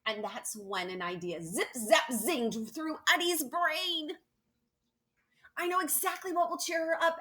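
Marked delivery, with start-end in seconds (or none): 1.4-4.2 zingy excitement. A narrator's reading. Sound-effect words of idea. 5.6-7.2 high, quick and bright with the idea; fast excited kid voice; excited eureka. Acted.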